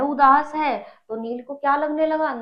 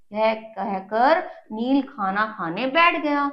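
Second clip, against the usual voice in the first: usual speaking voice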